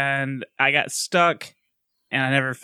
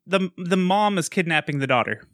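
usual speaking voice

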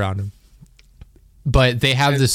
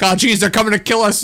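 higher-pitched pretend alarm; mock panic; 10 semitones higher than usual. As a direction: play at being scared, half joking.

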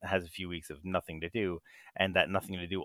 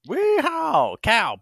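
high, loud yee-haw holler; cowboy whoop; 10 dB louder, much slower than usual. Acted. Teasing partner about the accent, loud high cowboy whoop.

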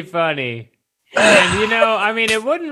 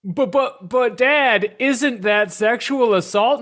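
higher, slower mock son's protest; a hint of a worried kid asking his dad; imitating Gohan; 7 semitones higher, slower than usual. Playing Gohan questioning his father, lightly higher. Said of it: "Gohan goes".

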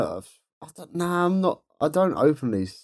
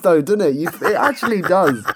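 usual speaking voice

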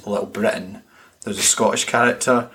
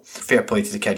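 usual speaking voice

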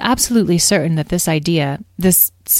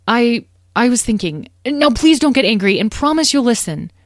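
hesitant start, then higher, urgent appeal; nervous pleading; 7 semitones higher, 6 dB louder than usual. Acted. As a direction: ask anxiously to be heard out. Nervous child pleading with mother.

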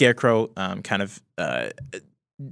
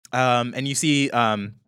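ordinary talk, nothing marked

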